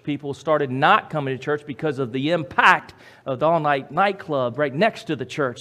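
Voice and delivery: ordinary talk, nothing marked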